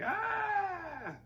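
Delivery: high, drawn-out mock scream; hammed-up shriek held on one high note. Girlfriend finding his body, high mock scream.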